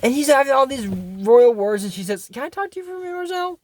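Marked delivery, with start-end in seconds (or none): 2.3-3.6 high, soft voice; hushed aside. Retold or imitated. Friend asks Marcel for a private word, hushed aside. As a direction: pull him aside in a small high voice.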